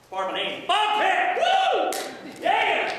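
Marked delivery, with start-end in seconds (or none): 0.7-1.4 Bobcat! shouted high and loud; with conviction. Shown as a demonstration. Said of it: "You gotta say it with conviction". 1.4-2.9 hype cheer. Acted. Mascot hyping the scouts.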